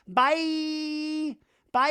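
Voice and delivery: high, drawn-out taunting call; aggressive mock goodbye. Zach waving aggressively at Jason, high mock send-off. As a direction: sing out a hammed-up hostile farewell. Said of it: "like, aggressively towards Jason".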